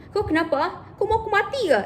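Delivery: mock offended parent, rising to a near shriek; hammed-up angry elder. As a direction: pitch climbing high into an offended outburst. Elder scolds dismissive child.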